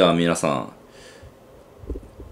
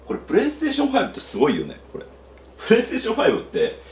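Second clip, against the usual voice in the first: excited; 13 semitones higher, much faster than usual. Real, not acted. Excited by the working connection.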